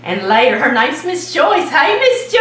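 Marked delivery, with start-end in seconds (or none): 1.7-2.4 high, loud cheerful greeting with a laugh; excited shout-out. Real, not acted. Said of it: "gonna give her a shout out".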